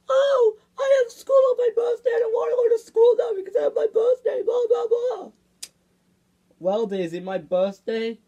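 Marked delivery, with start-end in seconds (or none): 0.1-5.3 loud, high-pitched mocking whine; mock complaining. Daisy imitating a birthday grumble about school, mock whining. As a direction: rattle off a loud falsetto complaint. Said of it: "then you'll be like".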